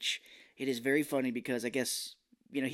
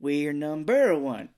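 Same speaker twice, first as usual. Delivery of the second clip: loud, drawn-out "number" with a swoop; cheer, stress on NUMBER; 3 semitones higher, 8 dB louder, slower than usual. Retold or imitated. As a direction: exaggerate "number" in a slow mock cheer. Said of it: "how many times can I change the inflection".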